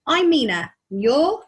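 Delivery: Mina, lilting and bored, repeating herself; bored sing-song. A narrator's reading. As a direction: sing it out as if he should already know. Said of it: "in a bored-sounding sing-song voice".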